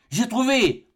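high, exultant eureka; triumphant cry. A narrator's reading. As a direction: cry out a sudden discovery, pitched high. Inventor exulting over his discovery. (cri de triomphe)

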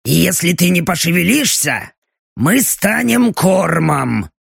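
clanging metal in an otherworldly voice; Belochka's metallic demon voice. Acted. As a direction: speak like a demon with steel in its throat. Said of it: "В голосе демона снова лязгал металл".

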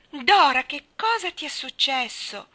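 Dora! called out high and loud, then slow; a shocked shout at a dripping child; alarmed cry. A narrator's reading. Anna crying out to soaked Dora, alarmed and loud. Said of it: "gridò Anna".

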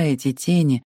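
ordinary delivery, nothing marked

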